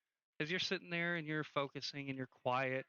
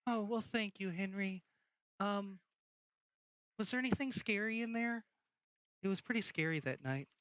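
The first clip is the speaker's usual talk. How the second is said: put-on high-pitched ghost girl; raised, lilting voice of a girl's spirit; Yella; 5 semitones higher than usual. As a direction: greet an old acquaintance in a higher voice. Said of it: "Yella comes out, and she's like".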